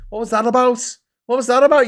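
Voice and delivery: high, loud exasperation; baffled complaint. Real, not acted. Streamer complaining to YouTube about stretched screen, baffled exasperation. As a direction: protest in bewildered indignation.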